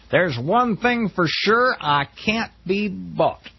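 mock the candidate's slow boast; lightly voiced Flint. Quoting Larry Flint's boast.

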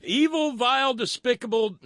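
high, loud mock denunciation; mocking echo. Host mocking guest who claims to be nonpartisan, hammed-up echo. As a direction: parrot the insults back, hammed-up and amused.

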